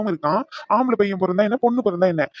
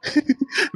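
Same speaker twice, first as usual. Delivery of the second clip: laugh; 8 semitones higher than usual. Acted. Husband laughs at playful fight.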